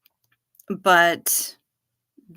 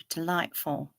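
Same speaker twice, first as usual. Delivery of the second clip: put-on English accent; 3 semitones lower, 8 dB quieter than usual. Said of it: "use English accents".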